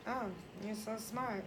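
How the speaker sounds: flat, soft. Shown as a demonstration. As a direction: mutter it as if you don't care. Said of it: "someone could say to you".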